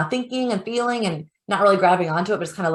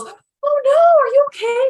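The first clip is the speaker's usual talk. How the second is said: high, loud alarmed cry of a startled mother; panicked mom; 7 dB louder, slower than usual. Retold or imitated. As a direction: gasp it out like a mom who fears the worst. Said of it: "if the mom goes".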